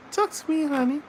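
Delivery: very high cooing voice. Real, not acted. Father cooing at his baby.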